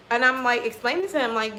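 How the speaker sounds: ordinary talk, nothing marked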